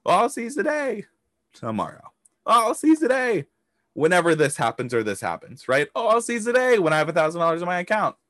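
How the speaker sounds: lightly mocking lazy excuse voice; high, airy mock excuses; mock procrastinator. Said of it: "Are you going to say".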